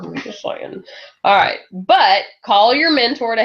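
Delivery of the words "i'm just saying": breathy sly whisper; whispered aside. Real, not acted. Speaker to her audience, whispered aside.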